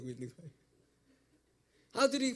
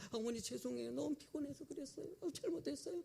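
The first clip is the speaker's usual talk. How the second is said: higher, softer timid apology; apologetic daughter-in-law; 7 semitones higher, 6 dB quieter than usual. Retold or imitated. Daughter-in-law begs her mother-in-law's pardon, timid higher apology. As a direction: shrink back and plead sorry.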